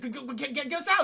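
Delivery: high, stammering plea; mock panic. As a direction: stumble over it in pretend panic. Retold panic in tractor beam.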